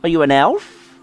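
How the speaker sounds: put-on high childlike questioning; 1 curious fairy voice. Fairy quizzing Mister Nobody, high and curious. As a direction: bounce the questions up like a nosy kid.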